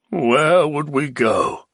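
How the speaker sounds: slow, mournful question; the Baron gloomy; melancholy. A narrator's reading. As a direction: sigh it out sadly. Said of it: "asked with melancholy".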